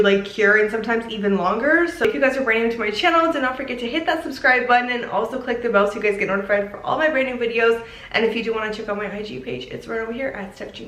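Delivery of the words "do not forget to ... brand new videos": ordinary talk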